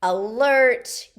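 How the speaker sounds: loud chant cue; called out. Shown as a demonstration. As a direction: belt the word with the move.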